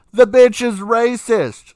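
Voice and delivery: indignant yell. Real, not acted. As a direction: slam the verdict down hard. Insisting on her quiz answer.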